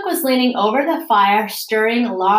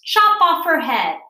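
commanding shout; 8 semitones higher, 5 dB louder than usual. A narrator's reading. Duchess orders an execution.

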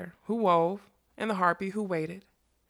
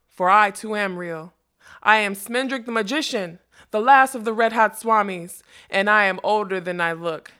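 loud, ringing self-announcement; proud declaration; 8 dB louder than usual. Acted. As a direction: proclaim your name and power. Magician proudly declares himself.

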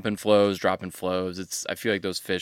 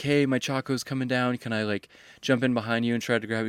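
higher-pitched mock hail to a stranger; retold calling out; 4 semitones higher than usual. Wader asking a stranger to let him in, higher retold plea. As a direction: act out an urgent request, without much volume. Calls to angler downstream.

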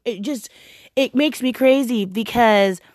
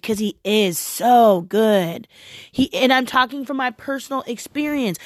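ordinary talk

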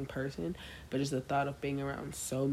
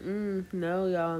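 usual speaking voice

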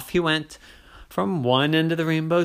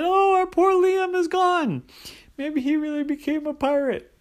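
mock wailing parent; 12 semitones higher than usual. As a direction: wail it like a mock-grieving mommy. Crying parents in story.

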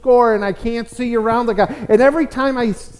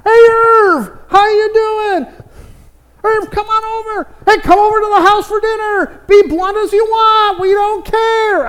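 much higher, louder, friendly welcoming call; cheery churchgoers; 12 semitones higher, 6 dB louder than usual. Retold or imitated. Church friends greeting the blunt father, much higher and cheery. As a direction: beam a hearty hello across the room. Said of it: "He was one of the favorite people".

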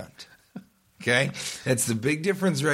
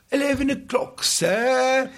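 mock British "sir"; 12 semitones higher, 3 dB louder, slower than usual. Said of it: "British time".

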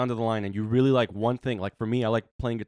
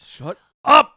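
laughing shout; 14 semitones higher, 14 dB louder than usual. Real, not acted. Laughing protest.

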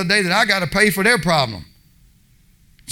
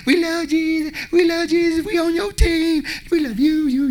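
high, sugary mock praise voice, much higher; mock churchgoer; 11 semitones higher than usual. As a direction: parody a fake-sweet churchgoer gushing. Mocking churchgoers' sweet praise.

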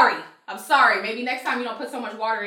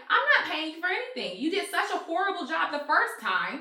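higher, louder indignant retelling; a woman reenacting her fight with a lazy painter, heated and raised; mock angry retort; 7 semitones higher, 4 dB louder than usual. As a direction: replay the argument, heated and higher.

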